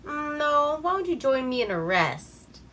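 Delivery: lightly smug guy. Retold or imitated. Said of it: "And he's like".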